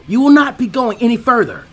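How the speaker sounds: high, loud, formal declaration; Lee, earnest. Acted. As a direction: stand him down with conviction.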